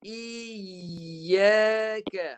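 "Iya ke" drawn out with a tightly held double y; four distinct sounds. Shown as a demonstration. Teacher wants to hear four sounds, held ya. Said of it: "saya kena dengar empat bunyi ni".

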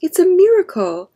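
high, loud storybook exclamation; joyful shout. A narrator's reading. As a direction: proclaim a miracle to the whole family. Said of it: "shouted Papa".

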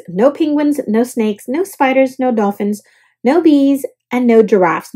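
ordinary delivery, nothing marked

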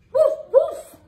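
high, loud playful woof woof; dog bark. Acted. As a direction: bark like a cheerful little dog. Dog barks in picture book.

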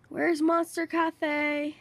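stretched, slow, stress on Monster; puzzled slow-motion asking; slow drawn-out question. Real, not acted. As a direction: drag out the words while searching. Asks twice, second time drawn out.

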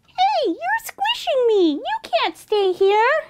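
very high, indignant squeak; high-pitched little creature protesting; squeaky little mouse. A narrator's reading. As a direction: squeak it like a tiny squashed mouse. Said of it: "a little voice squeaked".

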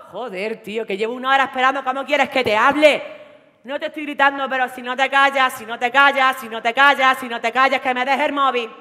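put-on loud, fast, furious yelling at her boyfriend; screaming "si no te callas" over and over; jealous yelling. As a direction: demand the phone, voice rising to a shout. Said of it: "en modo Antoñita".

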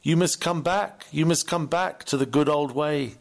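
ordinary talk, nothing marked

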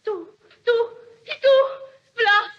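rising from loud to high, loud cries; frantic, fleeing the bloodstains; panicked shouts. Acted. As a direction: murderer screams herself into the woods.

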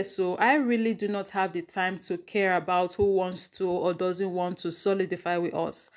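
ordinary delivery

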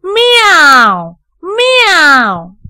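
high, loud cat's mew; kitten meow. A narrator's reading. Kitten in Heidi's pocket answers the housekeeper, high loud meow.

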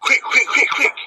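whispered hurry; urgent whisper. Acted. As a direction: hiss at your mates to hurry. Urging mates during break-in.

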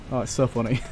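ordinary talk, nothing marked

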